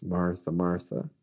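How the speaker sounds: low, soft correction; gentle rebuke. Acted. Jesus turning to answer Martha, quiet rebuke. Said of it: "Jesus turns and says".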